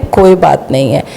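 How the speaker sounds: dropped low, mock shrug; sarcastic dismissal. To the interviewer, mocking how crimes get excused: low sarcastic shrug. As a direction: toss it off low like it's nothing. Sarcastic refrain after each charge.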